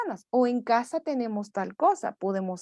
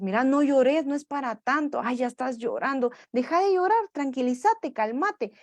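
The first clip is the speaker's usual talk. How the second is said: put-on higher, impatient parent telling the child to stop crying; scolding parent; 6 semitones higher than usual. Parent impatiently telling crying child to stop, higher scolding. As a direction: snap at the crying child, rising on "deja de llorar". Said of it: "tendemos a decirles".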